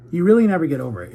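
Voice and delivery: insisting it firmly and fast. Real, not acted. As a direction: punch REALLY out loud and quick. Restarts the line more emphatically.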